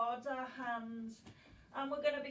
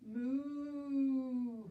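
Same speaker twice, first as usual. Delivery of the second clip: a playful cow moo. Pretend. Teacher back in cow pose with the kids, playful moo. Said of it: "Let's bring our cow in".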